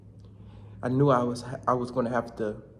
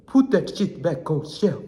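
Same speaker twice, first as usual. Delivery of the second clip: louder storekeeper in a put-on Arabic accent; mock Arabic accent; 3 semitones higher, 6 dB louder than usual. Storekeeper orders middle schoolers, in mock Arabic accent. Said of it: "he was, like, Arabic or something".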